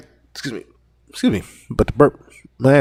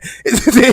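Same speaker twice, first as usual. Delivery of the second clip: laugh; 8 semitones higher than usual. Real, not acted. Laughing at the memory.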